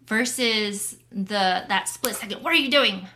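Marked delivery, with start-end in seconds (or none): 2.4-3.1 quick, sharp, judging question; sharp accusing tone. Retold or imitated. Mimicking husband's sharp tone at wife. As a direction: snap it out in a split second, accusing. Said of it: "With that, um, tone".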